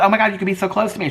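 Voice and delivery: high, quick, gushing; telling her sister she could live close by, high and fast; excited. Retold or imitated. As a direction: blurt it out in happy excitement. Excited at sister moving nearby.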